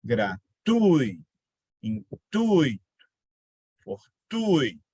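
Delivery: gratuito, intuito, fortuito said slowly, leaning on the u of ui; a slowed-down teacher demo of the ui diphthong; slow demo of the strong u. Shown as a demonstration. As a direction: drag each word out so the u is clearly the stronger sound. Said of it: "o som mais forte é o".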